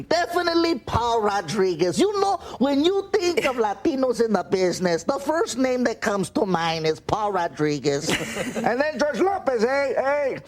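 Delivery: slow, high, drawn-out comedian impression; Paul Rodriguez. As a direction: stretch each phrase in a high put-on voice. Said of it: "pretty good Paul Rodriguez impression".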